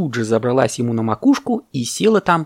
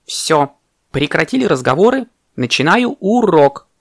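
louder, higher call to order; commanding teacher; 5 semitones higher, 4 dB louder than usual. Acted. Parrot teacher calls class to order.

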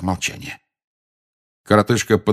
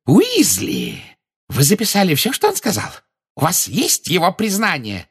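very high, thrilled questioning; trembling with delight; 11 semitones higher than usual. A narrator's reading. Minister demands the written confession, thrilled. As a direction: pitch it high with eager excitement. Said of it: "Он буквально дрожал от восторга".

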